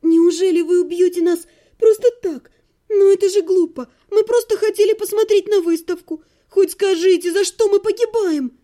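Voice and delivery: high, urgent protest; tearful pleading. A narrator's reading. Captive asking why they must die, tearful pleading. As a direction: plead for an explanation, pitch held high. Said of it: "У меня потекли слёзы".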